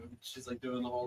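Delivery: ordinary delivery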